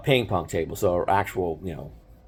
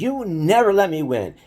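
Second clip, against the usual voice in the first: high, sulky complaint of a beaten little brother; pouty protest after losing again; whiny kid brother; 9 semitones higher than usual. Retold or imitated. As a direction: whine it like a sore loser. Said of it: "he would get so mad".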